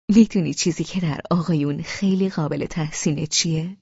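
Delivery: ordinary delivery, nothing marked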